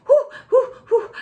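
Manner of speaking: high laughing whoops as the dress rides up; surprised whoops. Real, not acted. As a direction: yelp it out, half laughing. Dress pulled up suddenly.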